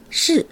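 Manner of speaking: 是 drawn out slowly as Mandarin shì; model Mandarin pronunciation. Shown as a demonstration. Teacher shows how the word is pronounced, slow careful Mandarin 是. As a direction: say 是 as one long Mandarin syllable. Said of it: "と発音し".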